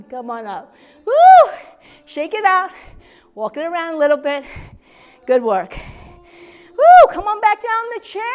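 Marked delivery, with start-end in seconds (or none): 1.0-1.5 high loud whoop of effort; cheer. Real, not acted. Fitness coach cheering after a tough set. 6.8-7.1 high loud whoop of effort; cheer. Real, not acted. Fitness coach cheering after a tough set.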